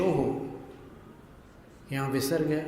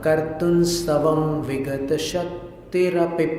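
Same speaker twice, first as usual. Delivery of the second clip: rhythmic recitation; 4 dB louder, slower than usual. Shown as a demonstration. Teacher recites the verse in metre for students. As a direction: chant the verse in steady metre. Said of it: "लय में पढ़ते हैं".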